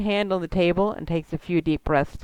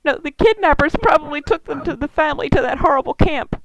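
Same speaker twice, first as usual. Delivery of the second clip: high, distressed elf woman; tearful clarification; 8 semitones higher, 4 dB louder than usual. Acted. Elf woman clarifying who was kidnapped, high and tearful. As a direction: correct them while still upset, voice high.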